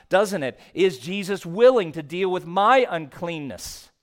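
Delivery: ordinary talk, nothing marked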